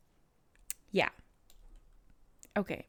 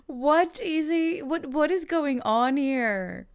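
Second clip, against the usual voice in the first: baffled exclamation; 5 semitones higher, 4 dB louder, slower than usual. Real, not acted. Exclaims at a chatter's message.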